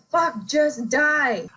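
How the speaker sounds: slow, drawn-out exasperated snarl; two monsters sick of fighting each other; exasperated fighters. Retold or imitated. Voicing fighting movie villains.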